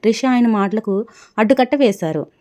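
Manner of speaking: ordinary delivery, nothing marked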